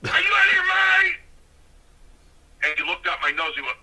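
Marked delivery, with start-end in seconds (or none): 0.1-1.2 high, exasperated yell, acted out; angry shouted scolding. Strongbow yanking me in the dressing room, angry shouted scolding. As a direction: imitate a furious veteran shouting down a rookie.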